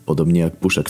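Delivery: usual speaking voice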